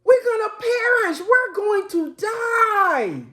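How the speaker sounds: frantic shout, voice cracking near tears; terrified yelling. Acted. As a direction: scream it like you're about to drown. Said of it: "And they're yelling".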